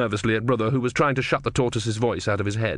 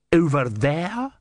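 menacing inquisitor; 6 semitones higher, much slower than usual. Acted. Vorbis slow.

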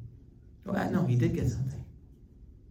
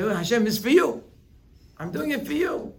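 grateful worshipper; 9 semitones higher, 5 dB louder than usual. Retold or imitated. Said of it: "We say".

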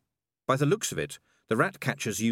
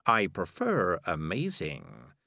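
low, slow, self-satisfied; dry and proud; smug cat voice; 4 semitones lower, much slower than usual. Acted. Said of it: "said Morris".

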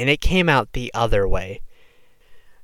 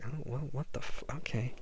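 quiet and casual; 14 dB quieter than usual. Retold or imitated. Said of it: "I was just like".

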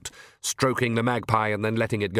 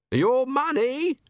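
much higher, slow, exasperated robber; swaggering gentleman highwayman; 13 semitones higher, much slower than usual. Acted. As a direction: spell it out to a fool. Highwayman repeats.

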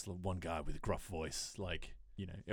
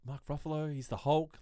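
a hint of outraged disbelief, higher; mock outrage, pitched up; incredulous; 6 semitones higher than usual. Imitating Edward Norton's reaction.

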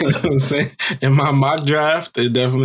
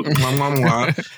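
big wet smooch; 2 semitones higher than usual. Pretend. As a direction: blow three kisses. Mock kisses to child.

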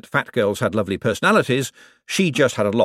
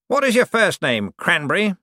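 condescending lord to servant; Cosmo's voice; 9 semitones higher than usual. Acted. To his butler, Cosmo's lofty patronizing voice.

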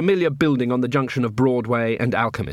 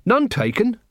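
high, cheerful reassurance; 10 semitones higher than usual. A narrator's reading. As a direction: brush off the apology brightly, pitched well up. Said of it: "said Carrot cheerfully".

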